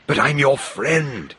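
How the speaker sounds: a high, slow plea; cried out. A narrator's reading. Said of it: "he cried".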